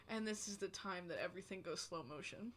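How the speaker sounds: very hushed, low inner thought; low, dazed near-mumble; dazed aside. Retold or imitated. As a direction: murmur it softly, as if time is slowing.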